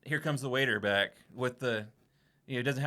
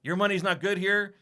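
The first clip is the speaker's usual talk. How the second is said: louder, higher, firm no; insistent refusal; 6 semitones higher, 7 dB louder than usual. Retold or imitated. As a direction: push the money back insistently. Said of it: "he's just like".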